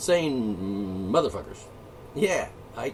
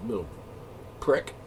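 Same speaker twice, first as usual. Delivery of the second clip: ordinary delivery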